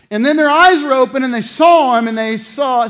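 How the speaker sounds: preacher's peak; climactic proclamation. Acted. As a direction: shout the revelation. Climactic narration.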